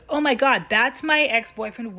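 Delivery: imitating the lady's stunned outburst; shocked exclamation. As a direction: blurt it out in disbelief. Lady shocked by reading.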